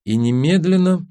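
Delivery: plain reading voice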